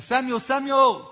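loud, high call across a distance; God calling. Retold or imitated. God calling young Samuel, loud and high. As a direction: call the boy's name out loud. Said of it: "God says".

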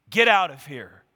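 low, forceful snarl of rejection. A narrator's reading. Amnon orders his sister out, snarling. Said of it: "he snarled at her".

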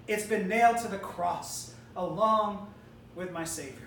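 ordinary talk, nothing marked

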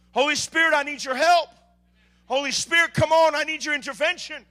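high, loud pleading cry; desperate prayer. Acted. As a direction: cry out to the Spirit.

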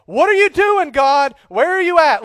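a frustrated shout at God, high and loud; exasperated cry to God. Retold or imitated. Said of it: "throw my hands up and say".

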